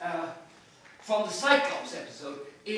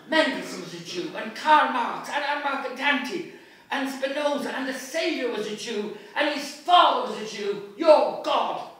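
building from raised to loud and high; defiant shout; 5 semitones higher, 8 dB louder than usual. Acted. Bloom answering the citizen's taunts, building shout. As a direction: hurl the names at them louder and louder. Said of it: "says he".

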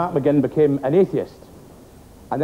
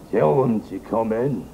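lower, slow hoarse man; 5 semitones lower, slower than usual. Acted. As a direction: rasp it out low and slow. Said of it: "came a hoarse voice".

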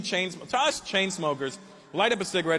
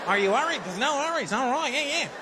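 put-on higher, quick Australian-accented ribbing; mock Aussie; 8 semitones higher than usual. Comic quoting the Australian crowd in an Australian accent. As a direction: parody the Australian reassurance. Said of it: "you have to tell everybody".

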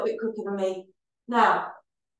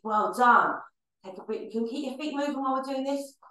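normal talking voice

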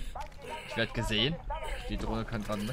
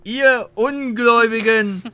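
mock biblical speech; 9 semitones higher, 15 dB louder, much slower than usual. Preacher rant in church game.

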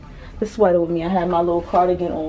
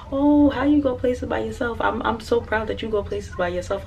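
higher-pitched imitation of amazed people; mock other people's wonder in a higher voice; admiring onlookers; 6 semitones higher, 4 dB quieter than usual. Solo traveler imitates people asking how she does it, admiring, higher voice.

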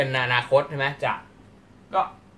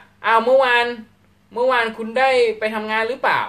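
raised, louder voice of someone asking; acting out a questioner; 8 semitones higher, 6 dB louder than usual. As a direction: play the friend asking about yesterday, a bit loud and high. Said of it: "มีคนถามคุณว่า". (น้ำเสียงคนถามที่สูงและดังขึ้น)

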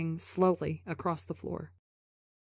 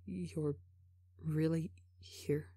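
very soft, slow disbelieving whisper; 2 semitones lower, 10 dB quieter, slower than usual. A narrator's reading. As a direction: whisper it haltingly, hardly daring to ask. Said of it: "as he whispers".

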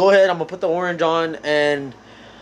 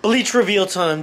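ordinary delivery

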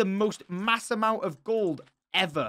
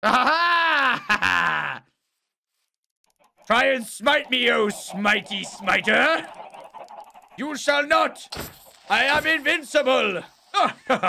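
loud, high gloating villain shout; megalomaniac rant; 7 semitones higher, 7 dB louder, much slower than usual. Acted. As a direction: roar like a triumphant overlord.

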